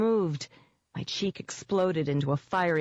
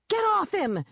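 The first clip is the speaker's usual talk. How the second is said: high, forceful yell; shouted order; 14 semitones higher than usual. A narrator's reading. Heroine defends her wounded friend from a monster, shouting. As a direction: shout it high to drive the beast away. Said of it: "I shouted".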